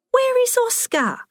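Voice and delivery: put-on very high-pitched elderly woman's question; bewildered old lady. A narrator's reading. Confused old Helen asks.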